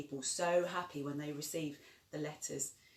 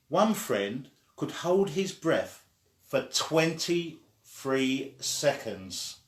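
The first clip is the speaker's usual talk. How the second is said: louder, steady storybook reading; story narration; 4 semitones lower, 8 dB louder than usual. Acted. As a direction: read it out like a bedtime story. Reads story aloud to child.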